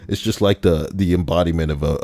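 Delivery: ordinary talk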